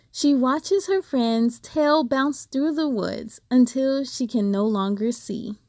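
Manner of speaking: plain reading voice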